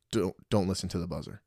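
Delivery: ordinary talk, nothing marked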